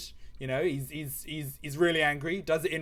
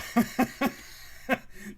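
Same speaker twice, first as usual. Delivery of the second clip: high wheezy laugh; real giggling fit; 9 semitones higher than usual. Real, not acted. Streamer joking to Sam about prices, real giggling. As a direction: crack up at your own joke. Cracks up at own joke.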